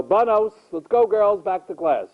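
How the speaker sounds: a hint of a principal rounding up students. Principal sending girls back to class, lightly imitated rounding-up call. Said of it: "until the principal comes down".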